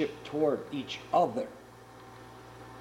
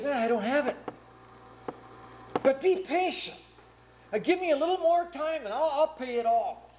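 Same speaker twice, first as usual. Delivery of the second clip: high, begging plea for more time; pleading debtor; 9 semitones higher than usual. Retold or imitated. Debtor begging the king for more time, high pleading voice. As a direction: beg the king to be patient.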